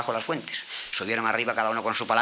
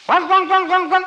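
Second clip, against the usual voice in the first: very high, loud barking howls; bay up at the hills to call the wolf; fox howling; 11 dB louder, slower than usual. Acted. Fox on the rock calling the wolf, loud high howls.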